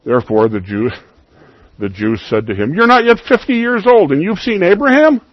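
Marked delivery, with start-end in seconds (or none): 2.7-5.2 high, loud scoffing disbelief; incredulous mockery. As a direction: sneer it like the doubting crowd.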